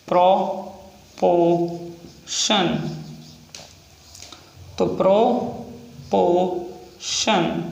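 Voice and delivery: Proportion drawn out very slowly; proportion stretched syllable by syllable; slow dictation. Shown as a demonstration. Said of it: "example proportion".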